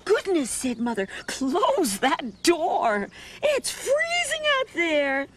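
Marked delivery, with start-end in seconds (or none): 0.1-0.5 a high, startled goodness; mother's surprised exclaim. Acted. Mother startled by the open door, high exclaim. 1.3-5.3 high, slow mother voice; mother's scolding. Acted. Mother tells Danny to shut the door, high and slow.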